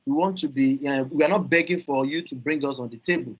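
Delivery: ordinary delivery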